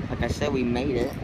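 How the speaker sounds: ordinary delivery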